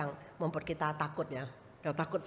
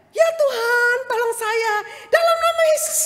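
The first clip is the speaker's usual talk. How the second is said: high, loud frantic prayer for help; retold scream at the snake; retold panicked cry; 14 dB louder than usual. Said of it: "Saya langsung teriak".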